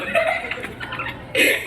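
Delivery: breathy unvoiced laugh; burst of laughter. Real, not acted. Preacher laughing at his own joke, a breathy burst of laughter.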